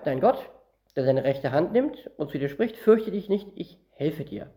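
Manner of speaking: normal talking voice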